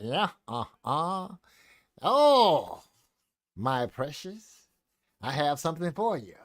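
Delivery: slow, drawn-out cartoon villain; slow theatrical villain menace. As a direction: creep it out like a comic book villain. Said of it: "generic comic book villain voice".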